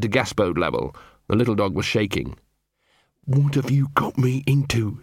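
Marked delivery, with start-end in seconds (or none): 3.2-5.0 growled. Acted. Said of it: "she growled".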